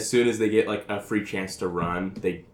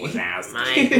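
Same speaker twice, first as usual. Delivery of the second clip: cartoon character impression; 13 semitones higher than usual.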